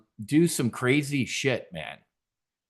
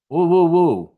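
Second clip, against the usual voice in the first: high, loud mocking hoots; a taunting woo woo woo; 5 semitones higher, 7 dB louder than usual. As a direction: hoot in derision. Mocking the judge's ruling.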